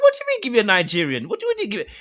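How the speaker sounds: a mock-shocked what do you mean; incredulous. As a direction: ask it in stunned disbelief. Incredulous reaction to women.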